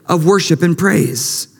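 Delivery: high, ringing preacher's voice; full-force preaching. Acted. Pastor urging the church to praise, high and ringing. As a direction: lift the church with every line.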